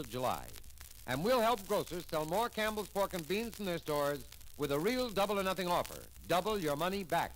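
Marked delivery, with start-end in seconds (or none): none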